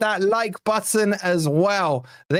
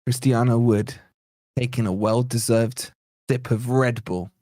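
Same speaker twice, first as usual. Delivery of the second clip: mock commentator voice; 7 semitones lower than usual. Streamer mock-narrates the striker drinking, in a low commentator voice. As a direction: lower pitched mock commentary.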